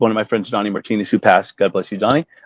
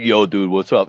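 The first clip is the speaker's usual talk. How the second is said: a lightly lowered, deeper-voiced dude greeting; 6 semitones lower than usual. Drummer greeting him in the trailer, hint of a deep voice. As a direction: drop the voice a little for the drummer. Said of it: "He's got this deep voice".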